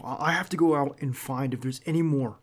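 ordinary delivery

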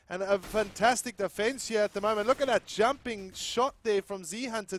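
loud, high, excited caster call; hyped play-by-play. Real, not acted. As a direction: ride the action, voice climbing. Live play-by-play rising hype.

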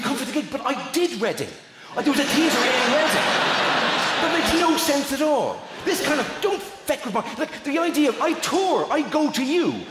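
high, excited rant about touring; comic rant. Acted. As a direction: work up into a mock outraged rant.

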